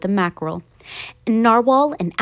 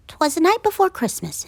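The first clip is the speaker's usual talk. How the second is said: plain reading voice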